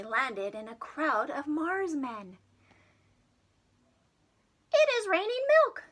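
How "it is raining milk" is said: much higher, fast squeaky little voices; Marsmen calling out. Acted. Marsmen calling out to Jimmy in high squeaky voices. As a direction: chirp it out like tiny aliens. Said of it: "they called out together".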